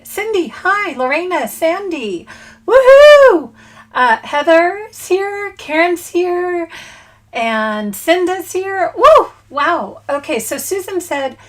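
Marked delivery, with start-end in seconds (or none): 2.7-3.5 high, loud happy whoop; bright loud cheer; excited woohoo. Real, not acted. Cheering viewers arriving on stream. 9.0-9.3 high, loud happy whoop; bright loud cheer; excited woohoo. Real, not acted. Cheering viewers arriving on stream.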